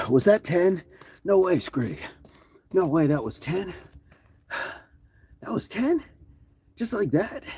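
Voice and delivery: can't believe it's over already; amazed disbelief. Real, not acted. Coach to chat after the set, astonished.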